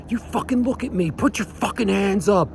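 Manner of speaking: very high, fast mock tirade; retold screaming. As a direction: squeeze a furious scream into a small, high voice. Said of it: "So I'm still screaming".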